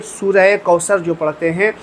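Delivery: ordinary talk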